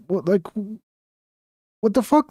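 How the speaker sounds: real disbelief thrown at the chat; incredulous. Real, not acted. Streamer venting at critics, incredulous outburst. Outraged at people's reaction.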